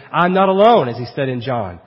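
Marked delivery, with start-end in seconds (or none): 0.1-0.9 raised, confident declaration; wholehearted rejoicing. Acted. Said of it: "could say wholeheartedly".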